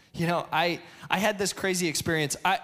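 usual speaking voice

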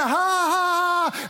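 high, drawn-out imitated "ha ha ha"; mocking class laughter. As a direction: mimic a class jeering in laughter. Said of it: "just start laughing at him".

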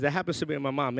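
usual speaking voice

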